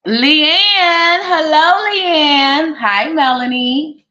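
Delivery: high, loud sing-song hello; sing-song greeting. Real, not acted. Greeting Leanne and Melanie in the live chat, high sing-song. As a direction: call out each name brightly.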